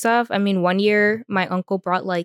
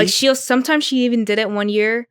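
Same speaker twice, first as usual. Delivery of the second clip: ordinary delivery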